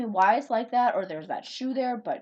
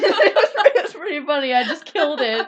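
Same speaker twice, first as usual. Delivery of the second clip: laughing; 5 semitones higher, 12 dB louder than usual. Real, not acted. Laughing at own joke.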